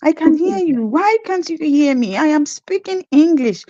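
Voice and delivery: high, insistent protest; indignant nurse insisting she speaks English; exasperated retort. Retold or imitated. Retort to Canadians.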